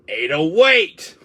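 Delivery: loud, high call; calling after Ada. Acted. Leon calling after Ada as she leaves, loud. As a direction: shout after someone running off.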